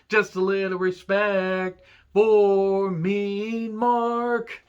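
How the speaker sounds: mock-dramatic slow wail for respect; drawn-out plea. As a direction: draw each last word right out, rising in pitch. Mimics Undertaker's plea for respect.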